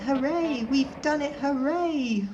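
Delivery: high, bright chant of hooray; cheering coaches. A narrator's reading. Coaches Annie and Clarabel cheering Thomas's win, high and gleeful. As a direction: sing out the victory as little coaches.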